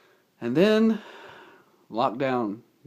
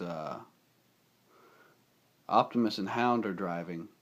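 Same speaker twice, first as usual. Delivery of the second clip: ordinary talk, nothing marked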